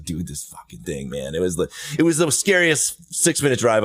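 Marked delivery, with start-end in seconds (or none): 0.0-1.4 much softer, low scared muttering; tense mutter. Retold or imitated. Driving with the snake moving in the box, soft scared mutter. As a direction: mutter it through fear.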